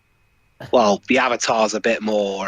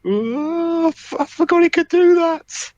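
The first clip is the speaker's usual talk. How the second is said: surprised; slower than usual. Real, not acted. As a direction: blurt it out in shock. Sudden shock at the scene.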